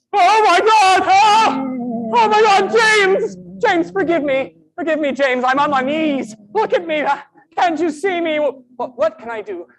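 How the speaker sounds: loud, high, tearful begging; anguished pleading. Acted. Guilty man on his knees begging James's forgiveness, anguished pleading. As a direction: cry out for mercy, distraught.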